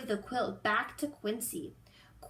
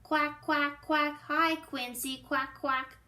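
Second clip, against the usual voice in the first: high, loud duck shouting quack; 4 semitones higher, 3 dB louder, slower than usual. Acted. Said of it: "shouts Dottie".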